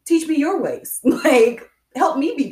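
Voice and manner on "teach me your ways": amazed bro. Retold or imitated. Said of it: "be like".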